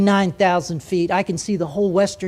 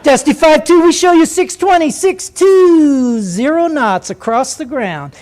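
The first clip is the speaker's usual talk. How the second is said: much higher and louder, slow and cutting; knife-edged controller voice; 7 semitones higher, 6 dB louder, slower than usual. Retold or imitated. Controller putting the Navy pilot in his place, cutting. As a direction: slice each number like a knife. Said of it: "See that little knife".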